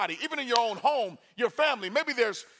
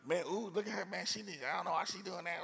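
softer, muttered mock gossip; gossiping churchgoer; 9 dB quieter than usual. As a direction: imitate someone quietly judging another person. Voicing gossiping church people.

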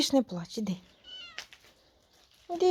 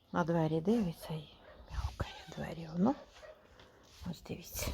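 soft whisper to the viewers; whispered aside; 9 semitones lower, 15 dB quieter, faster than usual. Real, not acted. Telling viewers in a whisper that the kitten is crying at the door. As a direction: whisper so the cat won't hear.

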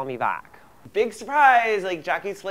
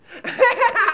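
short high giggle at her own joke. Acted. Girl giggling at her own mean joke about brother.

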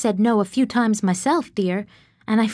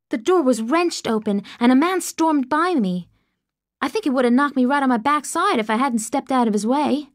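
usual speaking voice

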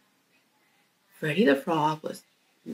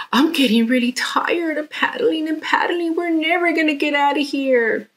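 tired whiny frog; 8 semitones higher than usual. Acted. Frankie in the back was like.

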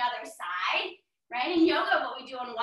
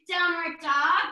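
ordinary talk, nothing marked

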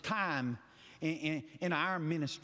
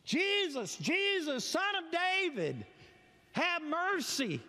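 high, slow pleading call; crying out; 10 semitones higher, slower than usual. Retold or imitated. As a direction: stretch out the plea for mercy. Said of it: "he continues to cry".

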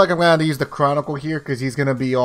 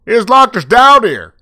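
higher and louder outrage; angry shout; 10 semitones higher, 10 dB louder than usual. Real, not acted. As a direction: yell it, furious. Locked in basement, outraged.

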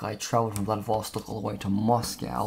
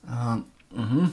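ordinary talk

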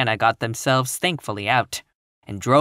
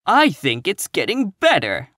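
firm, higher-pitched rebuttal; 6 semitones higher, 3 dB louder than usual. A narrator's reading. Catherine contradicting Eliza about the world, firm. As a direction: stand your ground, pitched up. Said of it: "said Catherine firmly".

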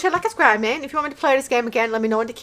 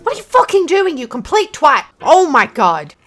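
furious shout at the screen; angry yelling; 4 semitones higher, 8 dB louder than usual. Real, not acted. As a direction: yell in real rage. Gamer raging at game.

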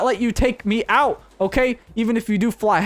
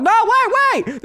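very high, loud alarmed cry; alarmed shout; 14 semitones higher, 4 dB louder, slower than usual. Real, not acted. Gamer shouting as a player steals his car. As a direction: yell to stop the thief.

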